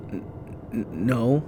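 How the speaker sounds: frightened stammer. Acted. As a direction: stutter out the no. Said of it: "Ralph stammered".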